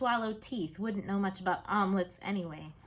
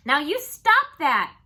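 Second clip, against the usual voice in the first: loud, higher, slow stern yell; father yelling; 8 semitones higher, 14 dB louder, slower than usual. Acted. Father yelling at Fudge after the thrown spoon, stern shout. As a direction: bark the order to stop. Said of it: "my father yelled at Fudge".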